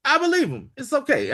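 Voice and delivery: high-pitched dismissive reassurance; imitated brush-off. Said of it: "I believe him like that".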